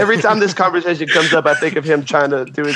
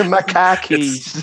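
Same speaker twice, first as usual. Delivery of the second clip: put-on slow, drawn-out Boston accent; 2 semitones higher, much slower than usual. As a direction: stretch it like a mock Bostonian. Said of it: "Yeah, Boston".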